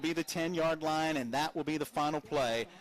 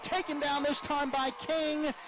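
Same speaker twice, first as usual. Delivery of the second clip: excited shout; 8 semitones higher than usual. Real, not acted. Big sack called in play-by-play.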